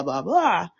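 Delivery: usual speaking voice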